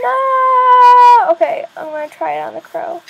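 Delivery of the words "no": high, loud no of dismay; sudden loud high no. Real, not acted. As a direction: cry out as it fails. Game failure.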